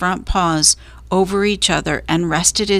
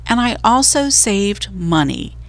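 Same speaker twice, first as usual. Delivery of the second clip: ordinary delivery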